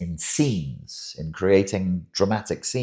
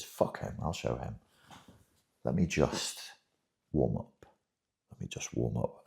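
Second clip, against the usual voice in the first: hushed, scheming inner voice, partly whispered; 3 semitones lower, 9 dB quieter, faster than usual. Retold or imitated. The borderline's inner voice sizing up a happy partner, hushed and scheming. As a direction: mutter it to yourself like a secret grudge. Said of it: "the voice inside my head is just saying".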